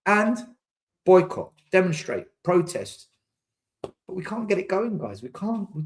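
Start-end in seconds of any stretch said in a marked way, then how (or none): none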